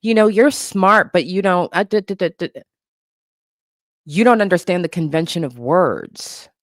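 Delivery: exaggerated sweetie-pie condescension; condescending. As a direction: imitate a commenter talking down to a silly child. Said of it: "the condescension, I would get comments like".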